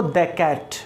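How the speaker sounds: "दे cat" with the weak pre-consonant "the"; "दे cat", no ee sound in "दे"; "the" before consonant. Shown as a demonstration. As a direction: say "दे" with a short neutral vowel before "cat". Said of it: "तो क्या बोलेंगे इसको".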